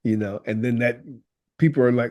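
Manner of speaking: usual speaking voice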